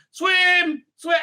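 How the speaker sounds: high, drawn-out cry; mock urging shout. Reviewer mocks the elf swimming in the ocean, mock urging shout. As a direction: call it out with mock urgency.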